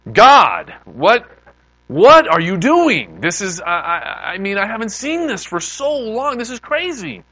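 loud, high cry of disbelief to God; hammed-up amazed exclamation; astonished outburst.

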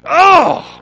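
wife's loud high shocked Oh; repulsed cry. Retold or imitated. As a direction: shriek as she walks into the room. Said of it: "Stacy coming in behind me going".

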